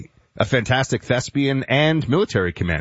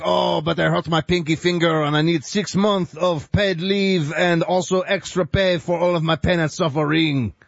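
higher, whining mock Frenchman; whiny Frenchman; 6 semitones higher than usual. Host mocking a French cop, whiny and higher. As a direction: play a fussy French cop begging for paid leave. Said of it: "like a guy named Francois is like".

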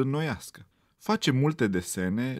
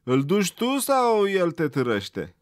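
loud, higher jeering voice; mocking taunt; 7 semitones higher, 6 dB louder than usual. A narrator's reading. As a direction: sneer at the little rabbit. Bully mocks rabbit's schoolbag.

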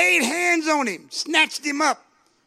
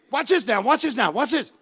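usual speaking voice

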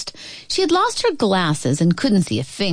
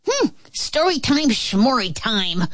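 dismissive scoff; 5 semitones higher than usual. A narrator's reading. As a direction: brush it off with a snort. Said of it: "blew Miss Lotta Scales".

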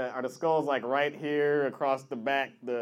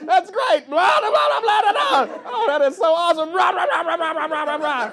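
very high, loud gibberish; mock babbling; 14 semitones higher, 9 dB louder than usual. Mocking a tattooed guy's chatter, loud high babble. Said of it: "It's like".

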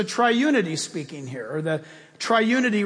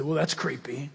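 skeptical listener; 7 semitones lower, 7 dB quieter than usual. Retold or imitated. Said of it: "You say".

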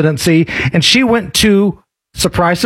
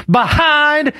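higher-pitched twangy imitation of a politician; mock Southern twang; 6 semitones higher than usual. Host mimicking a politician's speech, mock Southern twang. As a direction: mockingly put on a Southern twang. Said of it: "First of all, the word is behind".

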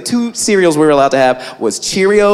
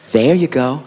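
smug mom; 8 semitones lower than usual. Retold or imitated. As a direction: slide it over slyly. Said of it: "and is like".

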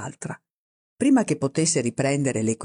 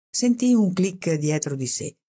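plain reading voice

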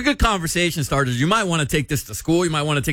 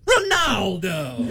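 drawn-out, higher-pitched mock holler; Big Al's greeting; 5 semitones higher, much slower than usual. Big Al announcing Ronaldo as he walks in, hollering. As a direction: call the name out, stretching it right out. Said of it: "'cause Big Al says".

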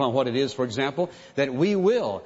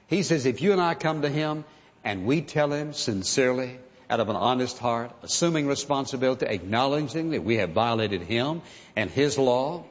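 ordinary talk, nothing marked

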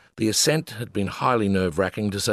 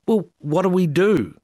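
higher, slow, sweetly pleading; as nice as possible; 9 semitones higher, 3 dB louder than usual. Retold or imitated. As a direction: ask as nicely as you can. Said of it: "as nice as we could".

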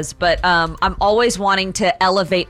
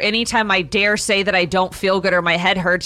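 normal talking voice

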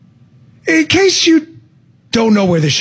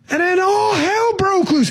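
high, slow shout on hell; cop's punchline; 12 semitones higher than usual. Retold or imitated. Host imitating a police officer at a debrief, shouting. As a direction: belt the catchphrase like the cop always did. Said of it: "He'd be like".

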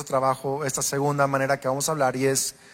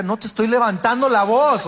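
mock defensive reply; 6 semitones higher, 4 dB louder than usual. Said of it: "Yo decía".

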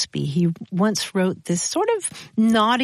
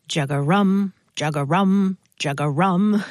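imitating a bullfrog's croak; frog-call imitation in three even beats; 4 semitones lower than usual. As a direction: do a lightly voiced bullfrog, evenly repeated. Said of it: "the sound a bullfrog makes".

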